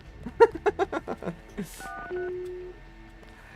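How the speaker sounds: long, high burst of laughter; amused laughter. Real, not acted. Joking about mother's accent, laughing. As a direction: crack up at your own joke.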